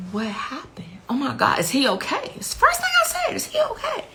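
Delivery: hushed start rising into high, anxious questions; climbing, worried questions without much volume; retold panic. Reliving own panicked first questions.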